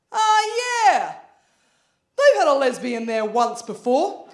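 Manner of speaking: slow, knowing local drawl; a lightly voiced Aussie bloke; mock deadpan local. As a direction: say it like it's an old local rule. Acted punchline quote.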